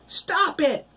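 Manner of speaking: very high, loud desperate scream. A narrator's reading. Girl screams at the taunting children, very high. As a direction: scream at them to stop. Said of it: "Danielle screamed".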